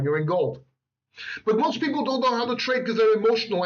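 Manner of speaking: ordinary talk, nothing marked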